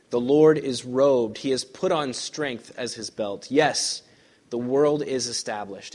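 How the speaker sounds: slow, proclaiming psalm; solemn scripture reading. Acted. Preacher reads Psalm ninety-three to the church, slow and grand. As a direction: proclaim it with majesty.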